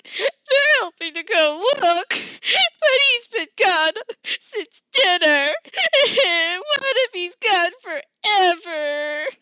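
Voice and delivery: put-on high, loud, slow sobbing child voice; crying child. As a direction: blubber through the tears.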